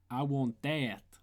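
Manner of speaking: loud, drawn-out Bronx accent; slow, forceful Bronx accent; emphatic Bronx accent. Shown as a demonstration. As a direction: push the Bronx accent through volume. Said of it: "use emphasis through volume".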